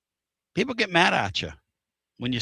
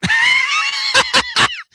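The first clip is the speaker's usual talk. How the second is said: loud, very high squeal of laughter; shrieking laugh; 7 dB louder than usual. Real, not acted. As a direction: burst out in a piercing laugh. Host bursts out at co-host.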